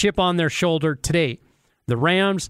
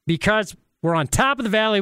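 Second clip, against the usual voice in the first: normal talking voice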